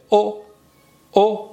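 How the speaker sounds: "او" as a pure o, jaw back, lips round; rounded back vowel. Shown as a demonstration. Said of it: "فک عقب".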